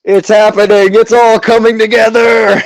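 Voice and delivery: high, loud hype; excited. Acted. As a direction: build it up like a showman. GM hyping the big shot.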